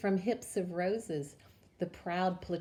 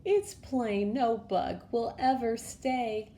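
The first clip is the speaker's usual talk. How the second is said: higher, tearful lament; sad lament; 5 semitones higher, 4 dB louder than usual. A narrator's reading. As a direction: mourn it, on the edge of tears. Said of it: "sadly sighed".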